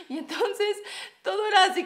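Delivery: laughing. Real, not acted. Laughing while retelling.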